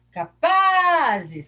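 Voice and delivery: PA in "Capazes" called out long, high and loud; stress on PA. Shown as a demonstration. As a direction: stretch and belt the middle syllable of "Capazes". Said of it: "quem que é forte?".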